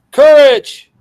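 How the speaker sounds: loud, high, commanding shout; commanding call. Acted. Jesus calls to frightened disciples, commanding. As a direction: call it out with authority.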